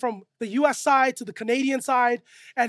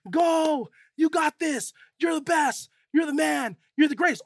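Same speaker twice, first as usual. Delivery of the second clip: higher-pitched mock crowd cheer; 4 semitones higher than usual. Crowd to the tightrope walker as he goes, cheering. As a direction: egg him on like an excited onlooker. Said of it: "they're cheering him on as he goes".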